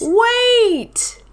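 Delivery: very high, loud storybook cry; high, drawn-out call of alarm; exclaimed "wait". A narrator's reading. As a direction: cry out to halt everyone. Bear stops everyone.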